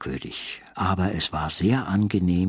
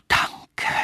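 rustling whisper. A narrator's reading. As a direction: fade it like rustling leaves. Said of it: "rauschte der Baum".

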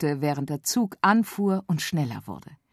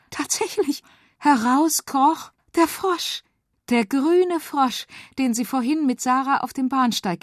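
surprised; 9 semitones higher, 4 dB louder than usual. A narrator's reading. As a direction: reveal it with wonder. Surprise reveal.

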